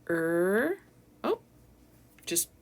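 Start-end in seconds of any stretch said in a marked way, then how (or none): 0.1-0.8 "Er" held long and loud as one sound. Shown as a demonstration. Teacher isolates the first sound of early, long "Er". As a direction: hold "Er" as a single r-coloured vowel. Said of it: "Let's say the sounds".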